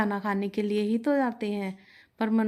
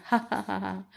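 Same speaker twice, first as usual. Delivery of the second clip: acted laugh of delight, ha-ha-ha; gleeful laugh. A narrator's reading. Father hearing his son's news about the rival, gleeful laugh. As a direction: laugh with pleased, scheming relish. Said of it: "Ramu बड़े खुश हुए ये बात सुनकर".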